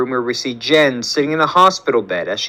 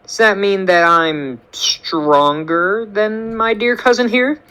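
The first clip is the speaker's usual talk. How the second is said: teasing; 6 semitones higher, slower than usual. Acted. Jen teasing her cousin.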